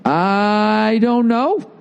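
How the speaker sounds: long, high, slow sing-song shrug; drawn-out mock shrug. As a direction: stretch it out like a careless shrug. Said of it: "Where am I gonna live?".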